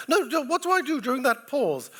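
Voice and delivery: high, loud mock actress voice; imitating the actress. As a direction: squeak it out like an anxious actress. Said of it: "He said".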